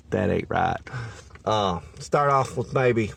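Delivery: ordinary talk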